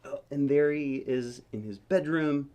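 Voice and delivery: ordinary delivery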